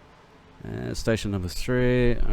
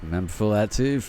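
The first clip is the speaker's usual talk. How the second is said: usual speaking voice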